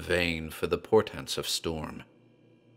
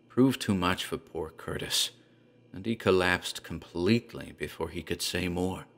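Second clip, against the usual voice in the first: ordinary delivery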